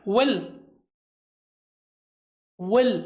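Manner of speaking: will with mouth open, clear w. Shown as a demonstration. Teacher showing student how to say will, mouth open. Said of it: "थोड़ा सा मुंह खोल के बोलना है".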